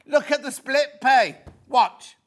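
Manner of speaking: high and loud, slowed for effect; showing off a bargain; excited. Real, not acted. Pointing out the split pay.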